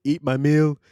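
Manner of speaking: loud singsong fake Jamaican accent; hammed-up fake Jamaican accent crooning. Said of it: "He's doing fake Jamaican accent".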